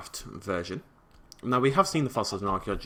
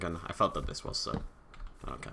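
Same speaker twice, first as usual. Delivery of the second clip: usual speaking voice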